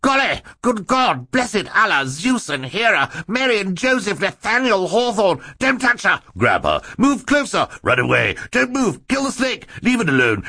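very high, frantic string of exclamations; panicked high-pitched babbling. A narrator's reading. Banker leaping about at the snake, high-pitched panicked babble. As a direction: babble whatever comes to mind in high panic. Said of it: "babbling anything that comes into your head".

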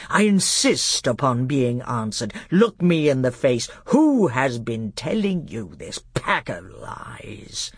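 stern, slowing demand; angry king. Acted. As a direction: interrogate with heavy, deliberate menace. In a voice of thunder.

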